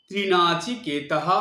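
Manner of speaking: ত্রিনাচিকেতঃ pronounced "trinachiketah"; visarga as h. Shown as a demonstration. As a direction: let the visarga sound as "h". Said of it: "এটা হ উচ্চারণ করতে হয়".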